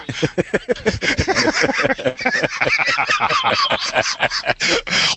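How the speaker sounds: long, high burst of real laughter; big laugh. Real, not acted. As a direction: crack up helplessly at the accent remark. Cracks up over accent remark.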